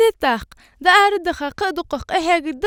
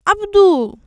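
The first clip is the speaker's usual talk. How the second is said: raised, higher-pitched name call; 4 semitones higher, 6 dB louder, slower than usual. Acted. One calls out to Abdu, calling. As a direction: call his name out loudly.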